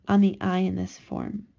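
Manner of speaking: normal talking voice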